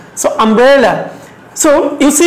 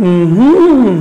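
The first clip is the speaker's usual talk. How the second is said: hummed stress pattern. Shown as a demonstration. Teacher shows students the stress of umbrella, hummed. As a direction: hum the melody of a three-syllable word, middle stressed. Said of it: "আমার সাথে করতে পারো এভাবে".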